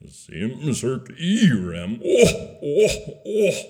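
backwards speech. Acted.

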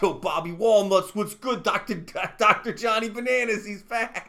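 loud, high mock wiseguy greeting; Italian-American accent. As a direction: call out like a Jersey mobster. Mock Italian mob nicknames.

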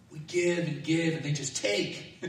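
slow, whiny self-pity with a laugh; mock complaint. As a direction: sigh it out like a martyr. Quoting common self-pitying complaint.

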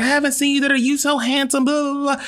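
put-on very high gushing older lady voice; high imitated older lady. Stranger at party gushing to him, very high mock voice. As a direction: squeal it like an excited stranger.

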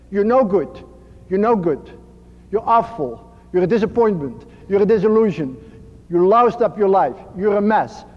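ordinary talk

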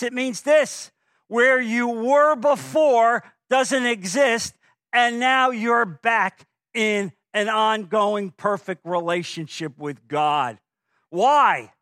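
loud, high, slow preacher's build; preaching at full force. Acted. Pastor pressing the congregation on reconciliation, full-force preaching. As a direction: drive the word home to the congregation.

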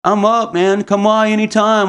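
high, slow, eager seller's voice; mock the overeager guy, pitched high; eager high invite. Seller calling him back, eager and high.

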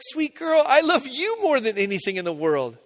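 fond reply that starts high and settles; retold fatherly gush, topping the child; doting dad. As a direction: coo it back to a little daughter. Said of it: "And I was like".

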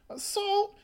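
shot up high in disbelief; incredulous. Acted. Echoing the word assault in outraged disbelief. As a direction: throw the word back in disbelief.

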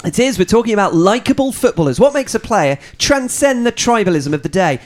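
podcast intro. Real, not acted. Host introducing the podcast and co-hosts, presenter voice. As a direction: announce the show and line-up like a presenter.

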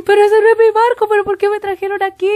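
high, loud and fast, imitating her own sobbing panic; retold crying. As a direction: replay the tearful wail of a lost kid. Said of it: "me largo a llorar como cabro chico". (imitando un llanto desesperado, aguda y rápida)

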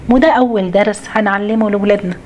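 ordinary talk

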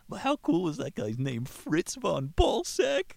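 high, slow gushing over the name; excited. Acted. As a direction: drag out "Balsweck" high and thrilled.

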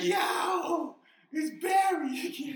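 high, loud, slowed shout; mock shouted greeting. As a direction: call it out loud and slow. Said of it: "He's like".

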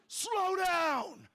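loud high shout of "Slow down!"; yell at speeders. Retold or imitated. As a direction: bellow at the speeding driver. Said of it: "I will yell".

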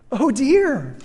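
put-on a frightened girl facing the lion; sudden anxious exclamation; dismayed girl's cry. A narrator's reading. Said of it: "said Jill".

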